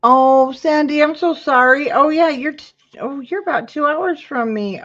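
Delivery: high, loud, bright-eyed hello to a viewer; light up at seeing a friend in chat; excited greeting. Real, not acted. Greeting a live viewer by name.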